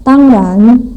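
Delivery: "tangran": flat tang, rising ran; tangran in Mandarin tones. Shown as a demonstration. Teacher modelling "tangran" for students, slow and rising. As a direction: say "tangran" with a level first syllable and rising second.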